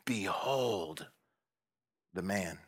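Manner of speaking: "Behold the man" soft and low; hushed. Shown as a demonstration. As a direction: let "Behold the man" sink in quietly. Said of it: "it means look, feast your eyes".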